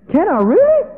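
very high, loud, delighted question; a boy getting permission at last; thrilled boy. Acted. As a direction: squeal it like a kid who can't believe his luck.

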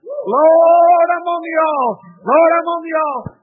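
high, drawn-out call to the Lord; crying out in prayer. Retold or imitated. Prayer going round in her mind, retold as a high cry. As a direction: repeat the prayer as a ringing cry.

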